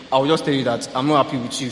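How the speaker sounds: normal talking voice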